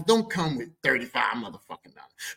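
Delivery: usual speaking voice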